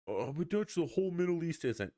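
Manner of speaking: high whiny mock objector; lightly voiced high nitpicker; mocking critic. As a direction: sneer like a pedantic commenter.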